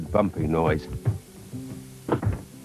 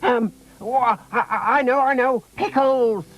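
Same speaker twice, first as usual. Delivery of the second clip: put-on excited child. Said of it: "shouted Robin".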